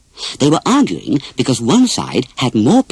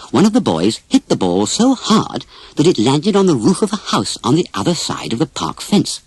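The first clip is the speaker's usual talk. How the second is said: ordinary delivery, nothing marked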